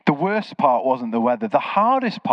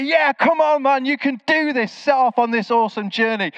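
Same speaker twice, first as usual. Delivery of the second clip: imagined cheer; 10 semitones higher than usual. Pretend. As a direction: pump him up like a hyped fan. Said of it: "He's gonna say".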